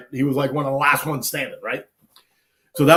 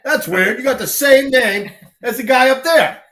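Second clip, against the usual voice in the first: higher, wry cop voice; mock cop, higher and amused; imitating a cop; 9 semitones higher, 4 dB louder than usual. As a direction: play the cop who spots the fake ID. Cop checking IDs, retold.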